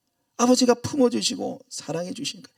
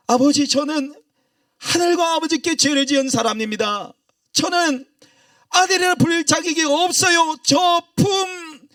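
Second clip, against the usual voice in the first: loud pleading confession; 9 semitones higher, 4 dB louder than usual. Acted. Son's rehearsed confession, loudly.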